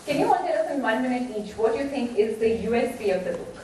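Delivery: usual speaking voice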